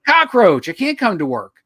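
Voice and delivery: high, loud bug voice; cockroach voice. Retold or imitated. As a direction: squeal it like a panicked cockroach. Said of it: "He's like".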